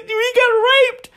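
high, tearful laugh-sob into a wail; mock crying victim. Imitating a grown man complaining to Candace Owens, hammed-up tearful wail. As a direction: imitate a broken man blubbering. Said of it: "The dude's like".

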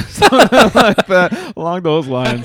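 words squeezed out through laughter; laughing. Real, not acted. Laughing while recalling away message.

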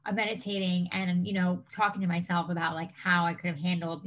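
ordinary delivery

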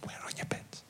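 hushed whisper, a bit quick. Retold or imitated. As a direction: lean in and whisper it discreetly. Costume girl quietly explains sock.

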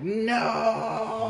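a high, strained cry of defiance; anguished no. Retold or imitated. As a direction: cry out against being taken over. Hero fighting control, acted cry.

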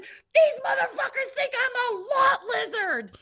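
very high, hammed-up cry; retold hysterical shriek of indignation; wailing outrage. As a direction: wail it like you've been horribly wronged. Retold outraged wail to brother-in-law.

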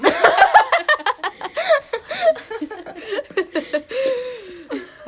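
long high laughing fit. Real, not acted. Hard laughter at joke.

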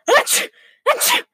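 high-pitched pretend sneezes, two in a row; acted sneezing. A narrator's reading. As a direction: sneeze twice, hammed-up. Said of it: "The wolf went on sneezing".

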